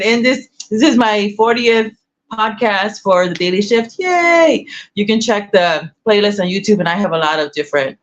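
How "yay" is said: high, drawn-out happy cheer; celebratory cheer. Real, not acted. Host celebrating a fortieth podcast, cheering. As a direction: cheer your own milestone in a high voice.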